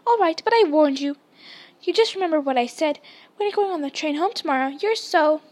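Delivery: heated parting telling-off; indignant warning. Acted. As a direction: warn them off in a huff. Hermione's angry last warning.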